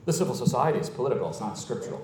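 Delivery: imitating a skeptic, low and hurried; dismissive mock objector. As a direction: toss off the objection under your voice. Voices an objector, then scoffs.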